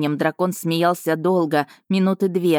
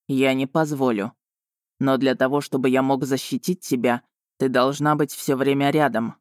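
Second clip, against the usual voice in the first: lightly voiced male dragon, slightly lowered; protective dragon Vargan; 3 semitones lower than usual. Dragon offers to protect Marina, firm. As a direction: promise protection in a lower, steady voice.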